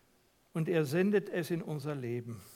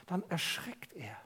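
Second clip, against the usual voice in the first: hushed unvoiced whisper, much softer; 9 dB quieter than usual. Real, not acted. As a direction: whisper the fright. Whispered dramatic turn in sermon. (erschrocken geflüstert)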